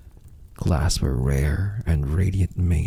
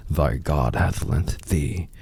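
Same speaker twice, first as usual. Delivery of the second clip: plain reading voice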